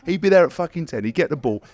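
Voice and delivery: ordinary talk, nothing marked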